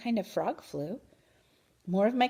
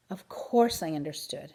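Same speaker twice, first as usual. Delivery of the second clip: usual speaking voice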